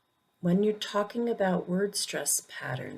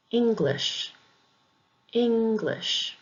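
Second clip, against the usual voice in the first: "English" with a high ENG, falling on the weak ending; "English" pronounced as a demo, high on ENG; first-syllable stress; 5 semitones higher, slower than usual. Shown as a demonstration. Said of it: "emphasis is usually on the first".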